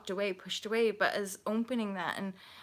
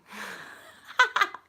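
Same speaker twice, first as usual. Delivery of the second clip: high laugh; 13 semitones higher, 6 dB louder than usual. Real, not acted. Laughs at own joke.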